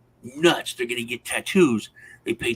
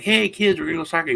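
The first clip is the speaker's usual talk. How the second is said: put-on higher, louder call to the kids; retold eager announcement; 6 semitones higher, 6 dB louder, much faster than usual. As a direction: call the kids over for an outing. Excited invitation to kids, retold.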